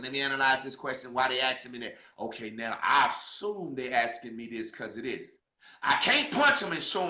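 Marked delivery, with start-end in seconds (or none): 0.0-5.3 low, hushed, quick self-questioning; lowered inner-monologue voice; thinking to oneself. Acted. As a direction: reason it through quietly to yourself. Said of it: "analyze that question".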